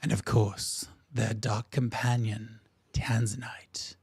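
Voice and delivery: hushed drops on the dramatic words; announcer's changed tone. Acted. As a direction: draw out the ominous introduction. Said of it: "his tone change. He goes".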